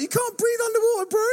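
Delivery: very high, hammed-up exclamation; incredulous. Speaker to the boy out of the pool, incredulous. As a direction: exclaim it in comic disbelief.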